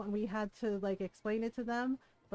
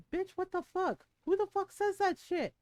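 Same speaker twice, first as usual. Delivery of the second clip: Ron's outraged reaction; 9 semitones higher than usual. Retold or imitated. Husband Ron hearing the story, outraged and high. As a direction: mimic the husband's disbelief up high. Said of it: "Ron was just like".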